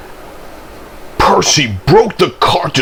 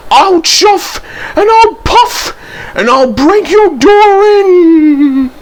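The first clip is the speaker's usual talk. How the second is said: slow, high, loud ghostly wail; spooky voice; 6 dB louder, slower than usual. A narrator's reading. Percy threatens to break in as a ghost, spooky slow wail. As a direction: threaten like a wailing ghost, stretching the final word. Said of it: "said Percy in a spooky voice".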